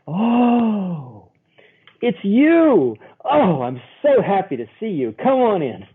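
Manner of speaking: high, bright-eyed welcome that soars on it's you; delighted welcome. Acted. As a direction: greet him like you're thrilled he's here. Smiling centerfold's imagined welcome.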